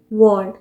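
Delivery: वर्ल्ड with the r left silent; British pronunciation. Shown as a demonstration. Teacher showing the silent r, British pronunciation. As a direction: say वर्ल्ड as "wuhld", no r. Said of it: "आर pronounce नहीं किया".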